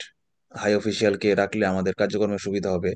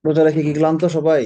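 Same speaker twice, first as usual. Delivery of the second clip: louder rousing; 5 semitones higher, 6 dB louder than usual. Real, not acted. Teacher rouses a tired class.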